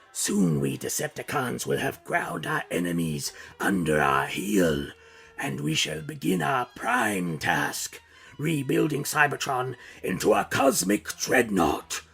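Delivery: menacing robot warlord voice; slow, grand threat from the tyrant; villain voice. Acted. Narrator voices Megatron's speech.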